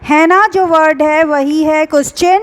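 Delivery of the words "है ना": louder, pressed repeat of "है ना"; emphatic repeat. Shown as a demonstration. Teacher repeating the key phrase loudly to students. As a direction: hammer home "है ना" for the class. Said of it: "मैं एक बार और repeat कर रही हूँ".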